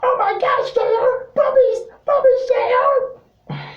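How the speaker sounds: very high-pitched, squealing excited commentator; Jerry Lawler. Retold or imitated. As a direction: squeal it like an overexcited wrestling announcer. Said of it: "He would be saying things ... like".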